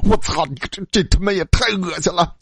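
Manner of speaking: high-pitched disgusted outburst; angry. A narrator's reading. Roommate cursing the revolting sight, angry. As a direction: curse in revolted anger. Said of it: "老二愤怒地说".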